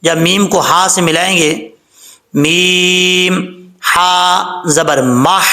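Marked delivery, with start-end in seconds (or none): 2.3-5.5 "ماہ" with a held, pressed ha and a slow rising spelling; stressed doubled ha. Shown as a demonstration. Teacher spelling out the shadda slowly for students. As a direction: spell "میم ہا زبر ماہ" slowly, leaning on ha. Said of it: "زور دے کے پڑھیں گے".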